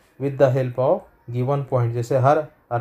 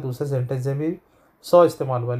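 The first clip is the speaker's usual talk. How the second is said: ordinary delivery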